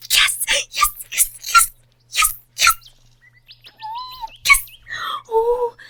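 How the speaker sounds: very high, loud shrieks of yes; squealing celebration. Acted. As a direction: jump for joy alone. Alone, celebrating after call.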